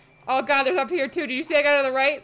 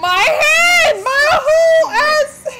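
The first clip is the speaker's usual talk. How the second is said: high, loud anguished yell; pained shout; 11 semitones higher, 8 dB louder, much slower than usual. Real, not acted. Gamer yelling as boss cuts off hand, loud pain. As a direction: scream it out as the hand goes.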